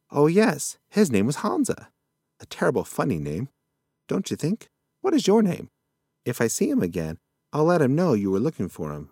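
put-on cheerfully muddled old-timer; lightly voiced old possum. Forgetful possum chatter.

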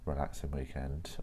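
ordinary talk, nothing marked

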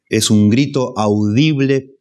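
ordinary talk, nothing marked

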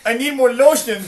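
very high, loud hysterical cry; hammed-up desperate wail; wailed shout. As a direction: scream it in a mock crying fit. Naked guest wails amid crying fit.

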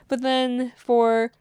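spoken through a big yawn; slow and stretched mid-yawn. Real, not acted. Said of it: "sorry, that was a big yawn".

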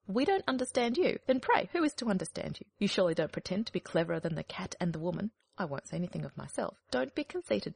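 scolding hen. Acted. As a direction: lecture like an offended hen. Hen's long scolding.

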